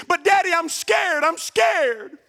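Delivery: put-on high, pleading child's voice; little girl at the top of the stairs, afraid; scared little girl. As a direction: beg your daddy, frightened in the dark.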